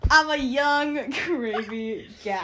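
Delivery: slow, loud laughing drawl of the gravy line; laughing bit. Pretend. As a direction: stretch it out while laughing.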